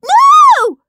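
piercing very high, loud scream. Acted. Peter clutching his diary against Henry, screaming. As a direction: shriek it, clinging on. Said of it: "screamed Peter".

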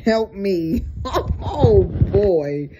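laugh-filled exclamation; weary laughing. Real, not acted. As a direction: break into a tired, loud laugh through the words. Laughs at own exhaustion.

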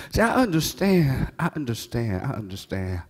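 fading. Real, not acted. Preacher trailing off softly to the congregation. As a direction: let it fade down.

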